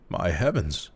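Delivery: plain reading voice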